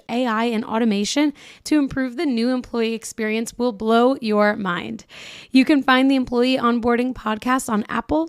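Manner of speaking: upbeat, punchy podcast promo read; polished host-read ad, key words punched; sponsor ad read. Acted. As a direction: sell it to the listeners with energy.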